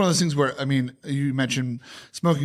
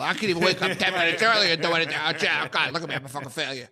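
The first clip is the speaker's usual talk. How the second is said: self-loathing whine; 5 semitones higher than usual. Retold or imitated. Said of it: "beating yourself up about".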